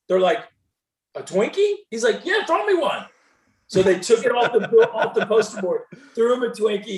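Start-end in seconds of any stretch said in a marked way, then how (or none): 1.2-1.8 high, rising mock disbelief; puzzled high-pitched question; incredulous fan. Retold fans' incredulous question. 2.3-3.1 insistent retort. Retold or imitated. Retold player insisting to the fans.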